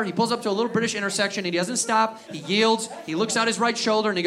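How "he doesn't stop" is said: ordinary talk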